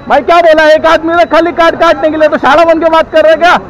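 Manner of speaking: loud, high-pitched indignant outburst; angry confrontation. Real, not acted. Rider confronting pump worker over card delay, angry. Real argument with petrol pump staff.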